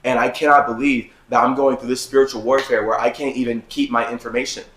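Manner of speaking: ordinary delivery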